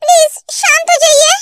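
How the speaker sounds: stammering, tearful plea; scared girl close to tears; very frightened. Acted. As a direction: plead timidly, tripping over the first words. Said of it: "ते बहुत डर जाती है".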